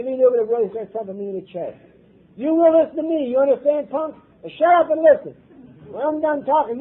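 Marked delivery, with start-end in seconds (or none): 2.4-6.8 high, loud commanding voice; stern loud order. Retold or imitated. Sponsor Joe ordering the sick man to listen. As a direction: lay down the law loudly.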